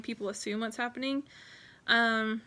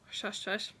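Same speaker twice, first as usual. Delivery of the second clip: a quiet slowed shush shush; hushed shush; 2 semitones lower, 8 dB quieter than usual. Shown as a demonstration. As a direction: drop to a secretive hush on shush shush. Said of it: "hush hush".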